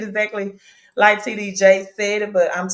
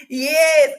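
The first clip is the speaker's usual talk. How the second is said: laughing; 9 semitones higher, 4 dB louder than usual. Real, not acted. Laughing gloat to viewers.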